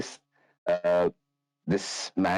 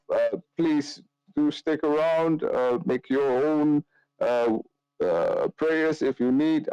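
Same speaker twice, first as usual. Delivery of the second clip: priest character; 8 semitones higher than usual. Acted. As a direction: speak as a courteous priest, pitched up and unhurried. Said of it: "and just goes".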